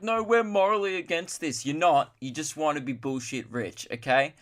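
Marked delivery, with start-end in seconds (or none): 0.0-1.7 high-pitched mock indignation; mocking protest. Celebrities pretending their reason is moral, mocked in high pitch. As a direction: mimic them in a raised, much higher pitch.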